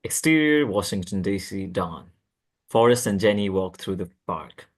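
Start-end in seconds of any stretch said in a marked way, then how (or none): none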